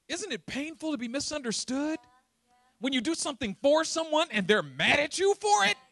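high, loud preacher's plea; full-force preaching. Acted. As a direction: press the question on the congregation.

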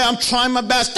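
ordinary talk, nothing marked

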